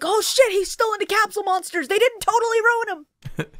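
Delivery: high-pitched, giddy outburst; retold excitement. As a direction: blurt it as a thrilled fan reaction. Retold excited reaction to character.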